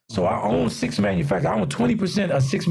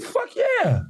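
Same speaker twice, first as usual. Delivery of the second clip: high-pitched, thrilled acceptance; retold eager yes. Accepting the designer's offer to come over, thrilled. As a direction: re-enact jumping at the offer, pitch leaping up.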